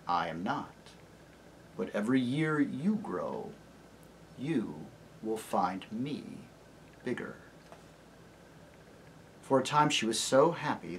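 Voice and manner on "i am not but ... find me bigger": low, soft and deep lion; gentle great lion greeting a child; low, tender lion voice; lion's voice. Acted. Aslan voiced.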